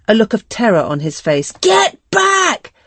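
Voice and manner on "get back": shrill high scream, full force. Acted. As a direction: scream it to drive him back. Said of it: "she screams at him".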